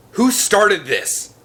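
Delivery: high, loud, slow furious shout; angry shout. A narrator's reading. Ian finds the campfire, loud angry shout. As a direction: demand an answer in anger. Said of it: "Ian shouted angrily".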